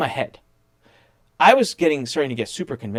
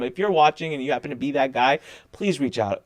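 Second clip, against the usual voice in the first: normal talking voice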